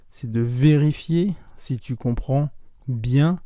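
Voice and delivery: ordinary delivery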